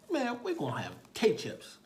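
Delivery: high, loud upbeat reply; talking to a child. Retold or imitated. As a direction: cheer a little kid on. Officer cheerfully answering the boy.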